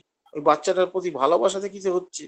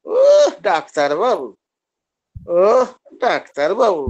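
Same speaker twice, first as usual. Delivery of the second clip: slow, drawn-out sarcastic "ওহ, ডাক্তারবাবু"; mocking sneer; much slower than usual. Shown as a demonstration. Patient taunting the doctor with a slow sarcastic drawl. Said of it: "আমাদের টিটকিরি মারছে".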